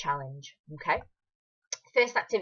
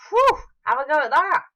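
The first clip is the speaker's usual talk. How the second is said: ordinary talk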